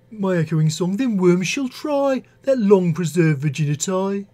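poem read in a Birmingham accent; Brummie accent. Shown as a demonstration. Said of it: "in a Brummie accent".

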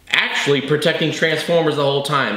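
ordinary talk

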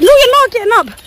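high-pitched imitated dog telling-off; mock shout. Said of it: "why were you shouting at Loki".

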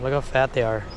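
ordinary delivery

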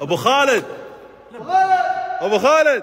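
calling out. Real, not acted. Calling a friend over.

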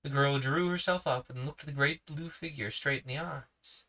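usual speaking voice

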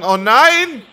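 very high, loud hammed-up cry of alarm; mock Goku cry. Imagining what Son Goku will say, hammed-up alarm. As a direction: overplay a panicked cartoon hero. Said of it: "wirst du sagen".

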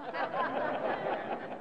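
soft breathy chuckle; quiet laugh. Real, not acted. Laughing at own confession.

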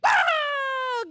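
a gleeful shout while bouncing high; excited yelp. Acted. Murray jumps on trampoline.

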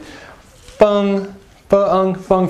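"Feng" held high and steady; high level first tone. Shown as a demonstration. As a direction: keep each "Feng" on one high pitch. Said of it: "First tone".

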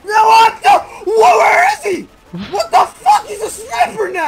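high panicked wail; frantic crying shout. Real, not acted. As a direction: cry out in desperate panic. Frantic cries under sniper fire.

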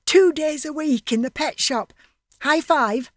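bursting with pride; excited Max. Acted. Max excited about job.

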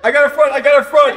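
loud, high battle call; urgent shout. Real, not acted. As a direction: yell your position to the team. Calling out in zombie game.

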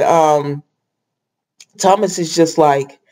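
usual speaking voice

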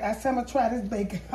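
rushing the words out quickly; talking fast. Real, not acted. Cook to her viewers, rattling off the line too fast. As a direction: hurry through it, words tumbling out. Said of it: "I'm talking so fast".

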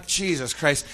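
ordinary talk

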